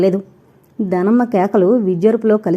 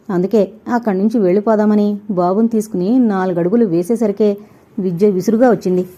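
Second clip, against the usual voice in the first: usual speaking voice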